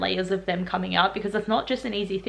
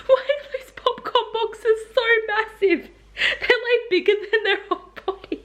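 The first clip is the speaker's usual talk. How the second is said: laughing disbelief; 11 semitones higher than usual. Real, not acted. Vlogger marvelling at popcorn, laughing high. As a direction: laugh through the words.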